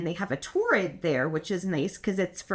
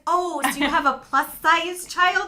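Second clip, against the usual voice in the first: mock saleslady; 8 semitones higher, 6 dB louder than usual. Mocking the saleslady's question.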